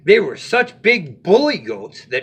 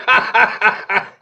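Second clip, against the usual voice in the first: mocking laugh; 6 semitones higher than usual. Goat laughs at ogre.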